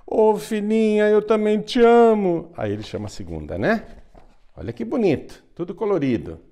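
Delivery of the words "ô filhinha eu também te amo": king's voice pitched much higher and louder; the king tells his eldest daughter that he loves her too, touched; moved king. Acted. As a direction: gush it like a deeply touched father-king.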